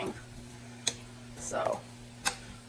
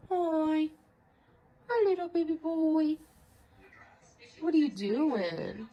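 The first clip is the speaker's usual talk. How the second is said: higher, sweet pet voice; 5 semitones higher, slower than usual. Real, not acted. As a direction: coo hello at the little dog. Greeting the other dog.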